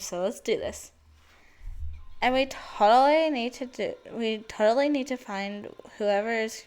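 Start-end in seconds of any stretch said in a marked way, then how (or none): 2.2-6.6 put-on Valley Girl voice. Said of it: "Last time I did my Valley Girl".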